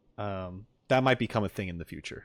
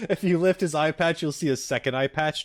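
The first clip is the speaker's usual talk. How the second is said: higher voice breaking out of a laugh; amused, laughing; 6 semitones higher than usual. Real, not acted. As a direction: say it through real laughter. Laughs reading an eye patch joke.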